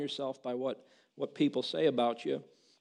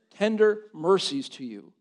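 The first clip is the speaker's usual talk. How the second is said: usual speaking voice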